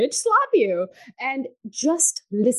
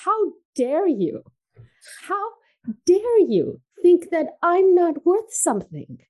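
indignant scolding; 8 semitones higher than usual. Retold or imitated. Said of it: "almost like a, a scolding from myself".

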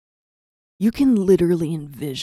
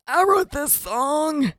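high, drawn-out strained wail; panicked blurt; 8 semitones higher, slower than usual. Retold or imitated. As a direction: blurt it slowly in a high, helpless voice. Blurting out under pressure.

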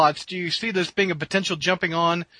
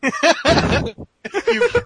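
high laughing; 11 semitones higher, 5 dB louder than usual. Real, not acted. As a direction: laugh while reading. Laughing reading question.

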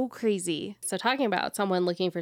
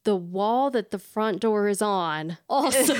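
ordinary delivery

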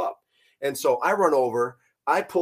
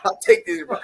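higher and louder deadpan joke; joking; 10 semitones higher, 6 dB louder than usual. Retold or imitated. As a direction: repeat it as a grinning punchline. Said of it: "You know, I was joking".

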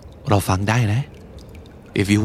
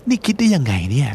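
usual speaking voice

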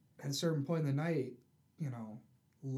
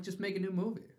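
slightly raised pitch, ending up; a light, higher-pitched plea; mild appeal; 5 semitones higher, faster than usual. Acted. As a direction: appeal to them without pushing. Pleading with filmmakers, first take.

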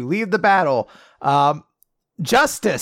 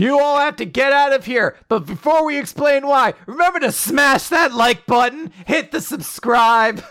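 higher, hammed-up hype, shouting the like button; mock YouTuber plug; 7 semitones higher than usual. Hermit's evacuation speech as a YouTube outro, mock hype. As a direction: parody a streamer begging for likes.

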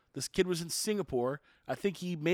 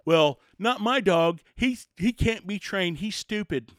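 mock excuse; 2 semitones higher, 6 dB louder, slower than usual. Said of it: "If you're sitting there saying".

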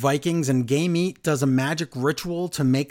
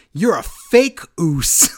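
drawn-out, louder mock accusation; slow it down and raise it like a wrestler's insult; acted accusation; 4 semitones higher, 6 dB louder, slower than usual.

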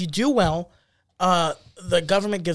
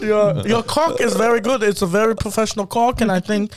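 mock German instructor; encouraging, put-on German-accented English; 2 semitones higher than usual. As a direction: give an appraisal in a comic German accent. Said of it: "your German accent".

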